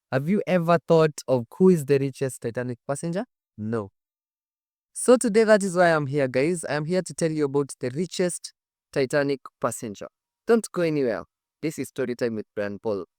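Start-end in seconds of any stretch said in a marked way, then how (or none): none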